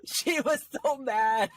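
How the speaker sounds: repeating it through high laughter; laughing. Real, not acted. Podcast host repeating a line to her co-host, laughing. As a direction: say it again cracking up, slowed down.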